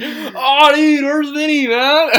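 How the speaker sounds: put-on high, loud drunken whine-shout; boozy mock holler; drunk friend. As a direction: impersonate a drunk pestering everyone for his pal. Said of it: "he just wouldn't shut up about Vinny".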